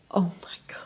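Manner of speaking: breathless, voiceless whisper; mother whispers at her daughter's kindness. Retold or imitated. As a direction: whisper it, overwhelmed and near tears. Overwhelmed by daughter's comfort.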